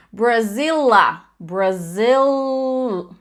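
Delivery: "Brasil" said slowly with a clear L at the end; clear final L. Shown as a demonstration. Teacher shows students how to end on the L: slow demo. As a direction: stretch "Brazila", then cut the final A off. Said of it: "é isso, ó".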